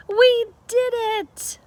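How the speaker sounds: high, slow, proud cheer; triumphant cheer. A narrator's reading. Amelia Bedelia celebrating with the boys, high slow cheer. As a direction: savor the victory out loud. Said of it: "yelled Amelia Bedelia".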